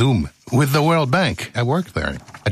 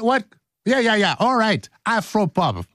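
normal talking voice

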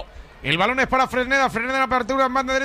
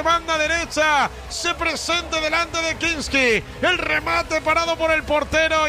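higher, slower replay of the goal; excited play-by-play; 5 semitones higher, slower than usual. Real, not acted. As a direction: keep the goal excitement while recounting.

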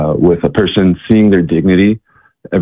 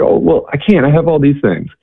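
reluctant excuse; 8 semitones higher than usual. Retold or imitated. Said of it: "and she's like".

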